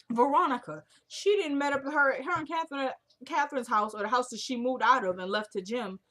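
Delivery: ordinary delivery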